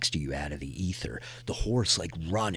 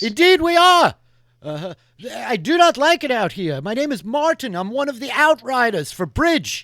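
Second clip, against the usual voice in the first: high, much louder rider voice; speaking up; 13 dB louder than usual. Acted. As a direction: project it over the vastness. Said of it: "Could you speak up".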